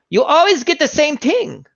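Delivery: put-on old Vietnamese woman. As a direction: tease him like the tiny waitress. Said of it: "she'd be like".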